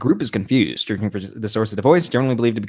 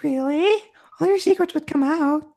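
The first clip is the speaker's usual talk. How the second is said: very high put-on voice; doubting girl. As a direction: question the choice in a squeaky voice. Voices friend questioning Logan's choice.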